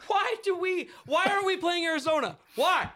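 very high, insistent whys; exasperated wailing protest. Acted. Fan asking why not Arizona, exasperated. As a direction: plead in a high shout, hammering the question.

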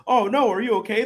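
high, loud fretting act; mock alarmed parent. Parent panics over fallen child, exaggerated high alarm. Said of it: "you're just like".

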